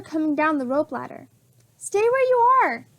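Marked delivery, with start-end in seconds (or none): 1.8-2.9 Jack's warning shout, pitched way up and loud. A narrator's reading. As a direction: call out to stop his sister coming closer. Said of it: "Jack shouted".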